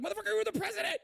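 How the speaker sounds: can't believe what he's hearing; exasperated outburst. Real, not acted. Streamer yelling at a politician on screen, pitch soaring in disbelief. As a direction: pitch climbing high, incredulous. Ranting at the clip.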